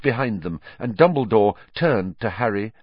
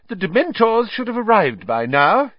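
high, loud official's voice; Fudge; 13 semitones higher, 6 dB louder than usual. A narrator's reading. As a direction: announce it loudly, pitched high.

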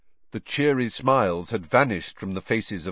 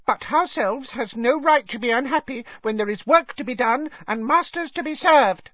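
high, squeaky, earnest elf voice; small high-pitched voice of duty; deferential house elf. A narrator's reading. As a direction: object humbly but firmly as a tiny servant. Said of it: "said the house elf".

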